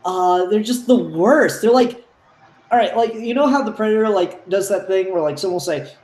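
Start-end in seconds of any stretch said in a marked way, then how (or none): none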